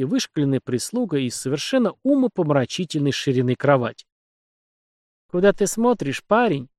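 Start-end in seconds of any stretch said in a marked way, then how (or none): 5.3-6.7 higher, slyly teasing; sly grin. A narrator's reading. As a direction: tease him with a sly smile. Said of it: "лукаво усмехнулся". (лукаво, с усмешкой)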